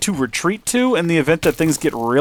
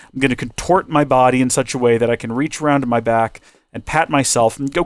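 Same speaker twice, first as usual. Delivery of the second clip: ordinary delivery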